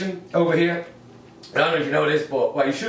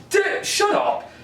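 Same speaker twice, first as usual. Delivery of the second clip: mock outburst; slower than usual. Mock outburst at imagined American.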